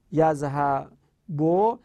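یذهبو stretched out slowly with a rise; very slow model word. Shown as a demonstration. Teacher drawing out the example for the class, slowly. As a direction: lay out یذهبو syllable by syllable. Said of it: "ولی در یذهبو".

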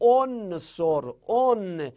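"انصر" as "on-sor", the n held; vowelless nun demo. Shown as a demonstration. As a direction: hold the n in "انصر", then "اون" as a drawn "onn". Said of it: "نون ساکنه".

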